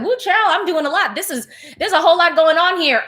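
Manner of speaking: mock inner exclamation. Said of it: "like, in her brain, she was like".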